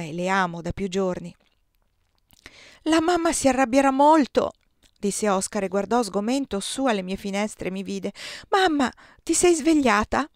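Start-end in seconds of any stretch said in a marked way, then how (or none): 2.8-4.5 put-on higher, louder child's line; worried boy. A narrator's reading. Boy worried about mother's anger. 8.5-10.3 put-on high, louder child's question; boy calling mother. A narrator's reading.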